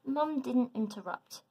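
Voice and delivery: plain reading voice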